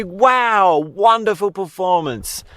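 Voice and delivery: high, wowed imitation of applause; mock crowd cheer. Crowd praising the quitting clown, mock amazed cheer. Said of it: "the crowd claps and cheers and they say".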